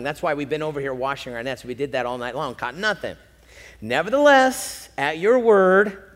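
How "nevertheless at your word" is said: reluctant emphasis. Acted. Peter reluctantly obeys Jesus.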